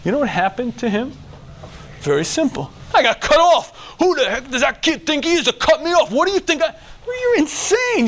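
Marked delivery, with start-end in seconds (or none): 2.9-6.7 indignant driver rant. Acted. Angry driver's inner rant. 7.0-8.1 incredulous. Acted. Incredulous reaction.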